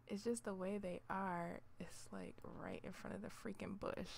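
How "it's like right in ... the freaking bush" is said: muttered. Real, not acted. Muttered frustration.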